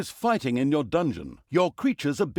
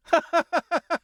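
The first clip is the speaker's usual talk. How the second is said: high, louder giggle; squeaky giggle; 4 dB louder than usual. Acted. Narrator laughing at his own pun, in a squeaky high giggle.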